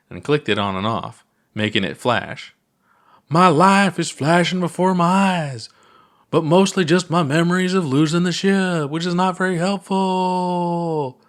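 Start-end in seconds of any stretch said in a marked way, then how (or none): 3.3-11.2 hammed-up puppet pilot.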